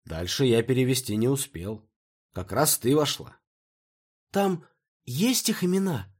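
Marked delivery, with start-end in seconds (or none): 4.3-6.1 high-pitched excited question; cried out. A narrator's reading. Maya asks Peter about the names, crying out. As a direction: burst out with it, pitched way up. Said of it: "вскричала я".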